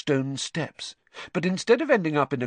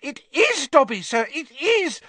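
high, loud, excited squeal; squealing elf; 5 dB louder than usual. A narrator's reading. Dobby hugging Harry round the middle, squealing. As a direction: squeal with elfin joy. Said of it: "squealed the voice".

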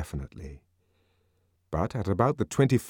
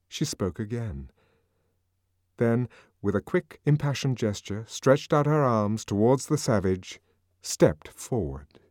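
usual speaking voice

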